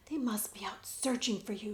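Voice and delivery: urgent hushed whisper. Acted. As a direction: whisper so the soldiers won't hear. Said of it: "Jemmy whispered".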